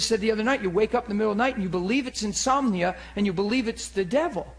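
ordinary delivery

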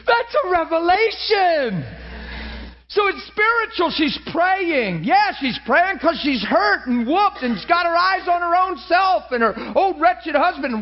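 high-pitched, fervent preacher; fired-up sermon delivery; impassioned preaching. Real, not acted. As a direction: drive the point home with urgent, high-pitched conviction. Preaches at full force.

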